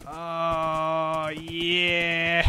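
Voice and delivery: long drawn-out "oh yeah" climbing into a laugh; gleeful cheer. Real, not acted. Player riding the skeleton horse, gleeful drawn-out cheer.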